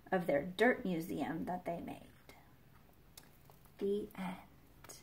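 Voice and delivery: hushed story ending; soft whisper. Acted. Reader closing the book, whispering. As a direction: whisper the close.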